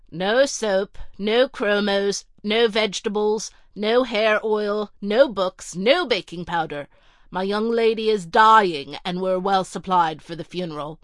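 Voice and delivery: a haughty butler reeling off a list of refusals; curt butler. Acted. Narrator voices the curt butler.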